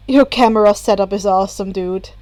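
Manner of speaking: put-on a chill older brother; casual Natsuo. A narrator's reading. Natsuo voiced lower.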